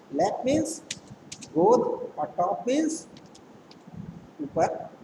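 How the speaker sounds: slow breakdown. Shown as a demonstration.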